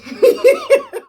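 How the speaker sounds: a big high burst of laughter; loud laugh. Real, not acted. Vlogger cracks up at her own phrase, laughing loudly.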